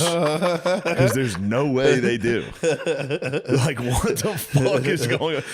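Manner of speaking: laughing while talking. Real, not acted. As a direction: get the words out through laughter. Laughing at own situation.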